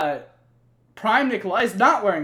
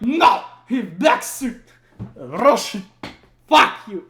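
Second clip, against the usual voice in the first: slow, higher, louder put-on voice; hammed-up drunk Russian; 5 semitones higher, 8 dB louder, slower than usual. Drunk Nikolai shouting back at his double, hammed-up. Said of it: "And he's like".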